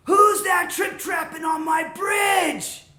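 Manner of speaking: loud, high, drawn-out roar; troll yelling. Acted. Troll under the bridge challenging little goat, yelling. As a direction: bellow it slowly, stretching the last word. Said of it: "he jumped out and he yelled".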